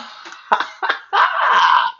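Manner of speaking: a sudden breathy high-pitched giggle. Real, not acted. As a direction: burst into a high little laugh. Bursts out laughing mid-reading.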